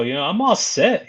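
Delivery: ordinary talk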